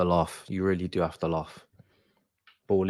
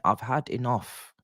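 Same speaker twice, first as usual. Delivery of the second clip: deflated; 3 semitones higher, 5 dB quieter than usual. Real, not acted. Fan deflated after outburst.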